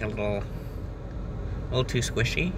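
normal talking voice

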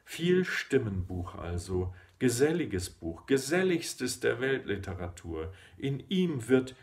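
measured, emphatic poetry reading with deliberate pauses; declaimed poem, pitch rising and falling with each phrase; poem recitation. Acted. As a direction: recite it line by line, lifting and stressing key words.

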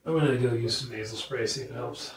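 stuffy blocked nose; talking through a blocked nose. Real, not acted. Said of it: "I can't breathe through my nose at all".

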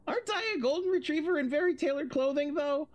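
high, quick teasing voice; mock-innocent high sing-song; cheeky tease. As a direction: bounce it up like a playful puppy. Dick teasing in his letter.

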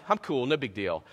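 mock-casual cover-up; feigned nonchalance. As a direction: shrug it off casually. Acts nonchalant after screaming to get off.

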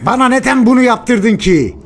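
anguished angry reproach. Acted. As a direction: cry out the blame. Father blames beaten son.

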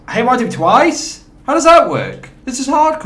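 high-pitched, indignant disbelief; incredulous protest. Real, not acted. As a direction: cry out in disbelief at an unfair result. Disbelief at hit markers mid-game.

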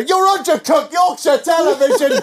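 hammed-up shouting villain; shouted accusation. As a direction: yell it like a cornered crook. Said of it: "I approached them later".

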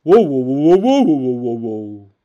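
big bear roaring loudly; loud booming bear growl. Shown as a demonstration. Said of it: "first I'm gonna do a bear sound".

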